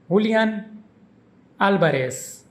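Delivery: Julian as hoo-lee-AHN, Alvarez as AL-ba-res; Spanish pronunciation. Shown as a demonstration. Teacher demonstrates the footballer's name, saying it the Spanish way. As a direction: Julian with an h sound and stress on the last syllable, Alvarez with a b for v and an s ending. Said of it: "is pronounced".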